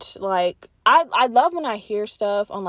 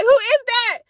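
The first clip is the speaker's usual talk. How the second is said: much higher, louder frightened cry; imagined fright; 12 semitones higher, 5 dB louder than usual. Pretend. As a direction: gasp at a stranger on the footage. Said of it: "I would be like".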